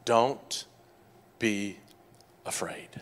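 soft, slow, each word held apart; tender reassurance. Acted. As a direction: speak it gently over their fears.

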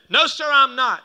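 high, loud emphatic denial; firm retort. Retold or imitated. Pastor answers dad's accusation, loud and firm.

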